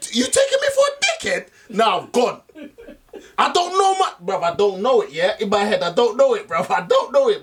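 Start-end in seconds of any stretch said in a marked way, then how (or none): 0.1-1.5 mock outrage. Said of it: "I would lie to be like".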